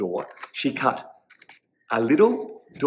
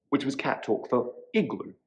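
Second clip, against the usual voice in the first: usual speaking voice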